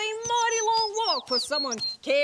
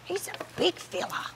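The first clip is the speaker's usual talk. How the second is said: hushed whisper; 8 dB quieter than usual. Acted. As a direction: whisper so the animal won't hear. Host hushes near animal.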